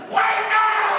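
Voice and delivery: high, loud shrieked wake up; ironic scream for a whisper. Retold or imitated. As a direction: scream it while claiming to whisper. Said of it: "calmly and quietly just whispered".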